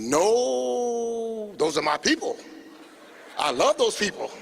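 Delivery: hammed-up earnest plea; mock protest. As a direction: sell outrage on his people's behalf. Skip protesting high price, retold.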